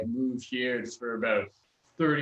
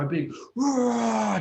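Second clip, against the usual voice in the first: high, slow bellowing roar of the words; dragon roar; 13 semitones higher, 4 dB louder, much slower than usual. Shown as a demonstration. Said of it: "do a nice big roar".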